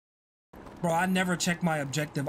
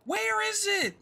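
high-pitched, frantic question; frantic cry; slower than usual. Real, not acted. Player lost in a timed course, frantic cry. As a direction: cry out, lost against the clock.

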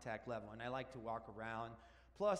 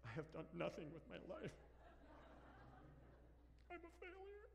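quick, hushed self-pity, then a high squeak on failure; mock despair; 7 semitones higher, 11 dB quieter, faster than usual. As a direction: ham up the midlife gloom, fading small.

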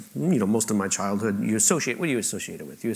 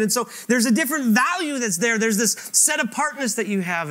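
higher, louder, swelling pulpit voice; impassioned preaching; 11 semitones higher, 5 dB louder than usual. Acted. Preacher telling the congregation God's name is on them, impassioned. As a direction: lift it toward the beautiful part.

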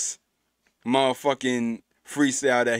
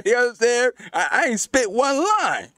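hyped; 11 semitones higher, 4 dB louder than usual. Real, not acted. Hyped laughing reaction to freestyle.

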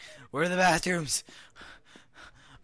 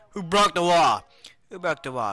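loud, high demand to know; astonished shout; 6 semitones higher, 10 dB louder than usual. Pretend. Prisoner finding the wall broken, astonished shout. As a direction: yell it in playful disbelief.

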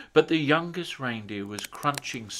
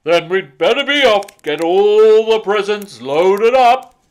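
rally the elves cheerfully; jolly Santa; 8 semitones higher, 16 dB louder than usual. A narrator's reading. Santa telling the elves to load up, jolly and loud.

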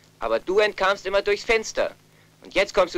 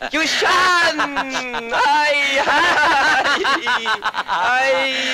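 joyful shout; 6 semitones higher, 3 dB louder, much slower than usual. Acted. Brother greeting his long-lost brother with an overjoyed shout. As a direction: call his name out loud, overjoyed.

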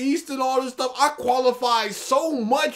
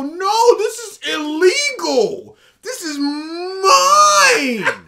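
high, loud whiny tantrum, laughing; foot-stamping tantrum; 7 semitones higher, 7 dB louder, much slower than usual. Retold or imitated. Mocking the creator's tantrum, high loud whine. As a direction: stamp your feet and wail it like a spoiled kid. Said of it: "stamp your feet and go".

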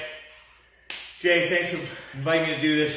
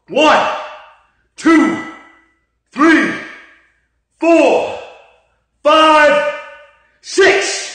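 loud, high, strained rep count; counting workout reps; 8 semitones higher, 10 dB louder, much slower than usual. Real, not acted. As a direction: bark out each rep.

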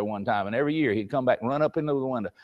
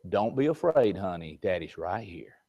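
low, soft and soothing, much lower; comforting father; 8 semitones lower, 8 dB quieter, slower than usual. Retold or imitated. Father comforts his scared daughter, soft and low. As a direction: hush her fears gently like a daddy. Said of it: "And he says".

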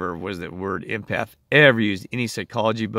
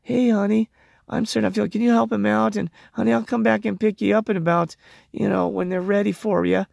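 much higher sweet-talking pet voice; mocking pet-owner coo; 10 semitones higher than usual. Mocking owners leaving their dog at the vet, higher cooing voice. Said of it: "be like".

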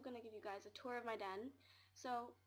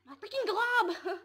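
high, very loud drawn-out shout; exasperated yell; 10 semitones higher, 18 dB louder, slower than usual. Real, not acted. Fed up with slow loading, exasperated shout.

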